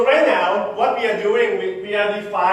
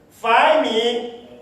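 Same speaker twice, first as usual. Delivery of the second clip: ordinary talk, nothing marked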